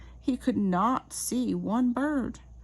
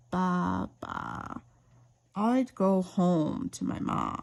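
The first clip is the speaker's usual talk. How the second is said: sheep voice; 4 semitones lower, slower than usual. Acted. Gentle sheep answers.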